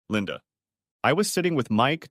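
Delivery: plain reading voice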